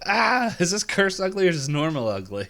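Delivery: high, loud cry easing into a worried question; alarmed outburst. Retold or imitated. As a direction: act out a shock at the mirror. Character alarmed at mirror, retold.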